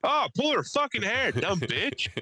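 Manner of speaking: playful high-pitched egging-on; mock fight heckling. Heckler at the back of a buffet fight, mock egging-on. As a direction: heckle a brawl for laughs.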